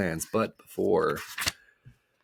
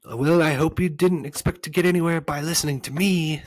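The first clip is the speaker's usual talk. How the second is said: high, loud mocking glee; gleeful; 9 semitones higher, 7 dB louder than usual. A narrator's reading. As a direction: gloat gleefully at the ones who fell for it. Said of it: "said the voice gleefully".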